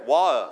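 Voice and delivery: water with the t dropped; water, no t between the vowels; pronunciation variant. Shown as a demonstration. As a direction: say water as "WAW-uh". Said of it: "ออกเสียงได้หลายแบบมาก".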